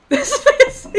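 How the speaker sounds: high, loud, laughing through the words; cracking up while trying to voice a character. Real, not acted. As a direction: crack up mid-sentence. Breaks up laughing mid-line.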